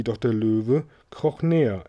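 ordinary delivery, nothing marked

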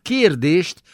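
usual speaking voice

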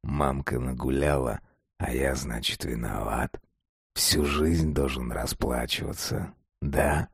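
low, hushed, self-pitying murmur; whimpering whisper. A narrator's reading. Father whispers his grievance to Masha, whimpering. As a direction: complain under your breath, low and creepy. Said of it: "шепнул он, поскуливая от возбуждения".

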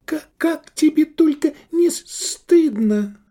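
high, outraged stutter; stammering with indignation. A narrator's reading. Matron's friend shaming the man, stammering with indignation. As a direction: trip over the words in outrage. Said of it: "Она аж заикалась от негодования".

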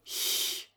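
airy "h" as at the start of huge; hissed palatal h, no vowel; soft ch. Shown as a demonstration. Teacher shows the other CH, soft airy sound. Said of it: "can be pronounced as".

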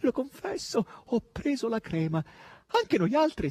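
high-pitched, eager confession; excited crying out. A narrator's reading. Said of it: "gridava Natascia". (confessione concitata, voce acuta)

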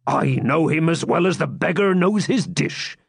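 high, confident friar's retort; bold. A narrator's reading. Friar insisting to Locksley that he knows the knight, bold. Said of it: "replied the friar boldly".

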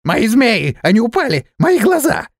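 high-pitched frantic cry, climbing to a shriek; panicked wail. A narrator's reading. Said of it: "Рострум завопил". (истошный высокий вопль)

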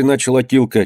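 ordinary delivery

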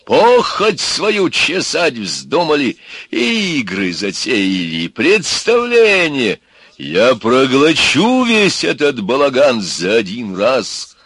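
громко и медленно, с высокими всплесками; греметь на растянутых словах, как разгневанный змей; громовой рёв. A narrator's reading. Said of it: "загремел он хором". (грозно, раскатисто)

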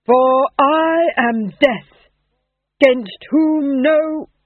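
slow, loud stage declamation; actor rehearsing Death. A narrator's reading. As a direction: proclaim doom to mortals. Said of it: "his first speaking part".

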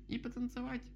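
tiny, very high squeal; squeaky little mouse. A narrator's reading. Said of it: "пыскнула Мишка-малютка". (писк маленькой мышки)

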